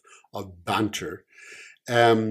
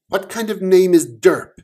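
higher, louder disbelieving cop; incredulous cop; 9 semitones higher, 8 dB louder than usual. Acted. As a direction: scoff at the silly name.

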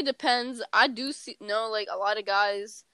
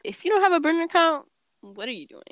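sassy scolding; 7 semitones higher, faster than usual. Acted. Mock scolding the listener.

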